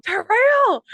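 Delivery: high, loud mock shout; imitated holler. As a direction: call out the name like the yelling mother. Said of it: "she's shouting Terrell".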